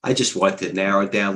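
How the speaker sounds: usual speaking voice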